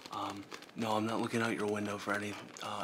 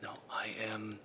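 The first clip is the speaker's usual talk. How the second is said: ordinary talk, nothing marked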